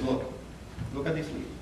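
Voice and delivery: ordinary delivery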